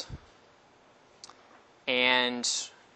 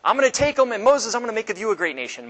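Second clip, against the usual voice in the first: high, loud, booming threat; the Lord threatens to wipe out Israel, loud and high; angry God; 9 semitones higher, 5 dB louder than usual. Retold or imitated. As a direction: raise the voice and threaten like a furious God. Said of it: "and the Lord again says".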